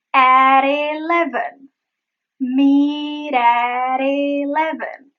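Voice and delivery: "Meet at eleven" very slow, stretched, Ts tapped; slow linked flaps. Shown as a demonstration. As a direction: draw out the vowels without breaks. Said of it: "It is meet at eleven".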